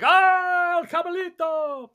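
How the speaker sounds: high, loud roar of the fighter's name; screamed cheer. Acted. Spectator screaming for El Cabalito at the fight, high yell. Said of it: "they're screaming, they're yelling".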